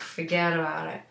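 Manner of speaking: light imitation of an Italian accent. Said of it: "It was like this Italian accent".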